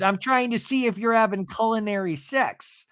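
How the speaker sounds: high-pitched goofy husband voice; high, sustained put-on voice; Seth imitation. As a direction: deliver it high, playfully overdone. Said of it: "my Seth imitation".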